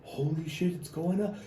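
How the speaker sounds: hammed-up awe, rising to a tiny falsetto; mounting excitement. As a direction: reenact mounting disbelief, ending in a high squeak. Reliving watching his likes climb.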